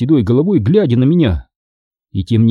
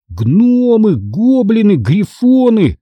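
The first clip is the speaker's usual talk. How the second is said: plain reading voice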